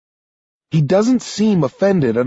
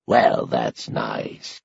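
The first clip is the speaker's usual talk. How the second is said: put-on low, slow, softer old man brushing it off; dismissive; 13 semitones lower, 5 dB quieter, much slower than usual. A narrator's reading. Old man dismissing agent Walker, low and slow. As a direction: wave her away with your voice. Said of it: "Sanderson says dismissively".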